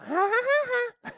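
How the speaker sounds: very high, loud cartoon giggle; Mickey Mouse laugh. Shown as a demonstration. Speaker capping a mock scolding, squeaky mouse laugh. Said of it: "does Mickey Mouse laugh like that?".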